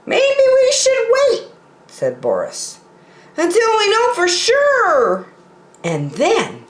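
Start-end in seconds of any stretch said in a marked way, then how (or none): 0.1-1.5 high buffalo voice with mild unease; a high character voice peering into the dark; Boris Buffalo uneasy. Acted. Boris Buffalo at the dark cave mouth, high uneasy voice. 3.4-5.3 high buffalo voice with mild unease; a high character voice peering into the dark; Boris Buffalo uneasy. Acted. Boris Buffalo at the dark cave mouth, high uneasy voice.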